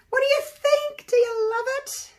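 very high, loud gleeful questions; excited squeal. Real, not acted. Asking viewers if they love the pour, excited squeal.

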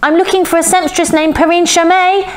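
put-on high-pitched, insistent girl; voice rising. A narrator's reading. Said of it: "her voice rising".

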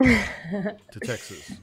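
Texas, drawn out slow, high and loud; mock Texan drawl. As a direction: stretch it like a hammed-up Texan. Teasing about a Texan accent.

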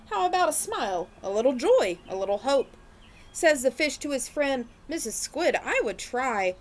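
lively picture-book read-aloud; reading to children. A narrator's reading. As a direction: read it like storytime for little ones.

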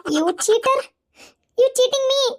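slow, high-pitched outraged charge; angry accusation. Acted. Woman accusing partner of cheating, outraged.

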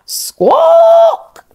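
very high, loud parrot shriek; parrot squawk. Acted. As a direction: screech like a parrot. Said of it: "cried the captain's parrot".

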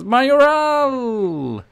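name yelled high and loud, stretched out; excited shout. Real, not acted. Gamer yelling his striker's name at the screen, excited shout. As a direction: roar the striker's name as he shoots.

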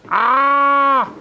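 high, drawn-out cry of "ah"; long, high yelp; startled "ah". Real, not acted. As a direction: cry out at your own slip. Mistake: doing it backwards.

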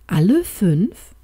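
slow, doubtful "Alle fünf?" rising at the end; unsure. A narrator's reading. Doctor doubting his wife's plan, slow and unsure. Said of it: "Die Stimme des Doktors hatte einen unsicheren Klang".

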